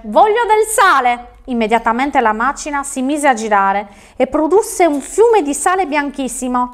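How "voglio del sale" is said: high, slow spoken command; out loud. A narrator's reading. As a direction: proclaim the wish in a raised pitch. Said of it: "disse ad alta voce". (a voce alta, scandito)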